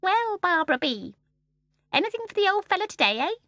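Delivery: high, coaxing bird voice; wheedling voice. A narrator's reading. The starling begs Barbara for food, wheedling. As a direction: wheedle for a treat, high-pitched. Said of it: "in a soft, wheedling voice".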